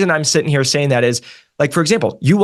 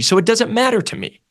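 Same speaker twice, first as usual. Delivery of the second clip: ordinary talk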